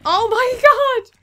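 high, slow shrieking oh my god; terrified scream. Real, not acted. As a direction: shriek in real panic. Real fright at monsters.

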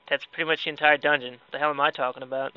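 normal talking voice

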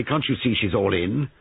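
a touch of protective indignation; angry. A narrator's reading. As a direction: scold the bully with restrained anger. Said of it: "said Giles angrily".

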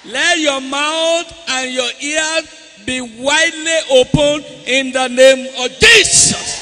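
loud, high praying, shouted in the name of Jesus; fervent prayer. Real, not acted. Pastor praying healing over the boy, loud fervent prayer. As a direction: pray over him at full force.

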